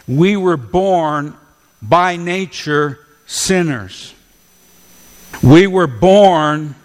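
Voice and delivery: slow, deliberate "we were born"; slow down, let "born" sink in; slowed for weight. Shown as a demonstration. Preacher slowing down so the church hears it. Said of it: "don't say that too quickly".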